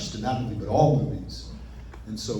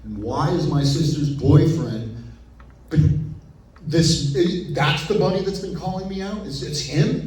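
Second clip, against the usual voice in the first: acted baffled disbelief, higher and louder; freaking out; 5 semitones higher, 6 dB louder than usual. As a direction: play stunned questions, pitch climbing. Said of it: "He's, he's freaking out".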